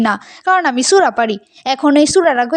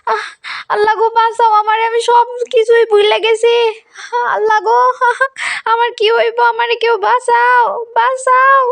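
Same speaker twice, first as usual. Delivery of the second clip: high, panicked pleading; 6 semitones higher than usual. Acted. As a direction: cry for help in terror. Girl panics, forgot prayers.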